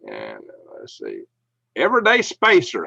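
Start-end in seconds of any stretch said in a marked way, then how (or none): 1.7-2.8 excited greeting. Real, not acted. Greeting a viewer by name.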